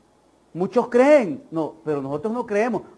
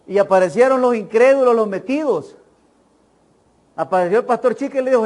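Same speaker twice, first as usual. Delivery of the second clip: ordinary talk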